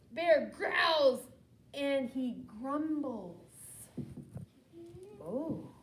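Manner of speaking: usual speaking voice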